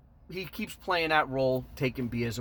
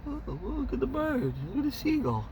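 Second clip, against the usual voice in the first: high, sing-song mock of a scared guy; 12 semitones higher than usual. Mocking the guy who looked away, high and airy. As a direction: pretend not to notice, high and airy.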